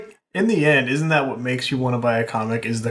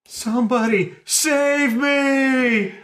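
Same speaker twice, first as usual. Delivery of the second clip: high, slow wavering cry for help; fluttering cries for help; 13 semitones higher, much slower than usual. Acted. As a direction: wail it like a damsel in distress. Victim cries out in comic.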